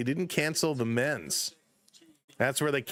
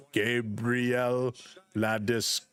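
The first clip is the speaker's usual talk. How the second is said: "Gabriel" and "Landeskog." drawn out slowly with a pause between, lower; slowed-down name pronunciation; 5 semitones lower, slower than usual. Retold or imitated. Streamer imitating the app's slow syllable-by-syllable name read. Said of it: "then they slow it down to pronounce all the syllables".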